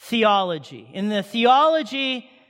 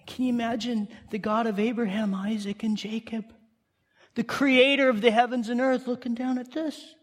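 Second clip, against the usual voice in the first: ordinary delivery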